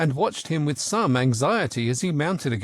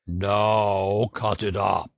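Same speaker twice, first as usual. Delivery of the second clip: slow, lower lion voice; a lumbering lion, drawn out slowly; the lion speaking; 5 semitones lower, much slower than usual. A narrator's reading. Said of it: "said the lion".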